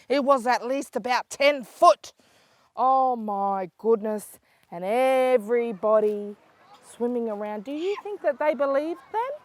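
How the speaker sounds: ordinary delivery, nothing marked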